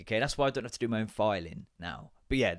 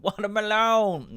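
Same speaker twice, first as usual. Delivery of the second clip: delighted exclamation; 8 semitones higher, 5 dB louder, much slower than usual. Real, not acted. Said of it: "excited at flavour".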